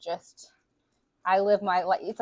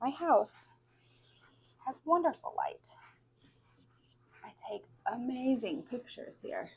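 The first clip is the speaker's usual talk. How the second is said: softer, higher, half-whispered correction; soft affirmation; 4 semitones higher, 9 dB quieter than usual. Shown as a demonstration. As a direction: murmur it like a mantra. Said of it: "listen to me speaking that into existence".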